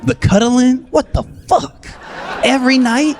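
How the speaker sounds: high, disbelieving cry; incredulous. Acted. Incredulous comic rant.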